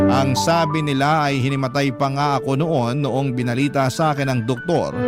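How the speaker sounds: usual speaking voice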